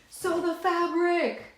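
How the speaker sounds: high, slow, hammed-up battle cry; high rallying cry. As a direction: belt it out like a war cry.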